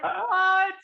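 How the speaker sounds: high-pitched incredulous "what"; squeaky retold astonishment; stunned disbelief. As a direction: re-enact being baffled, voice shooting way up. Acts out his stunned reaction.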